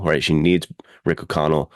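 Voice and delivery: ordinary talk, nothing marked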